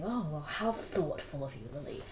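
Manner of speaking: snide fake praise; sweet on top, sting underneath; mock-polite passive aggressive jab. Shown as a demonstration. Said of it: "a very passive aggressive way".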